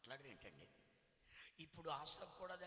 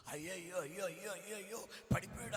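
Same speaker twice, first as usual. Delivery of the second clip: rising, louder alarmed ayyo cries; 4 semitones higher, 6 dB louder than usual. Retold or imitated. Dreamer watching dancers fall off the cliff, alarmed cries. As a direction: cry out in dismay as they fall.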